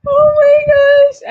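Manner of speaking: high, loud, slow laughing outcry; helpless laughter. Real, not acted. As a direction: squeal it out through real laughter. Cracking up after her accent attempt.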